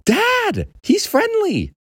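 put-on very high-pitched boy's cry; urgent insisting. Boy grabbing dad's face to be heard, urgent insisting.

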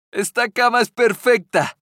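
a touch of glee as she settles in; cheerfully. A narrator's reading. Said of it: "dijo alegremente". (con un toque de alegría, voz algo más aguda)

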